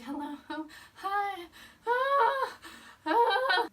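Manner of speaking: high-pitched flustered greeting breaking into a cry; overwhelmed fangirl squeal. Retold or imitated. Fan meeting her idol, overwhelmed squealing. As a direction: squeak it out, totally starstruck. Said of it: "and I was so overwhelmed".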